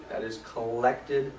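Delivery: collected drawn out slowly and leaned on; stress on collected. Shown as a demonstration. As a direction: spell out that the rent must be collected. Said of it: "I emphasize the word collected".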